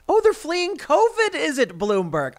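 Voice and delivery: high, slow sarcastic disbelief; mock the headline with a high drawn-out sneer; incredulous scoffing falsetto. Real, not acted.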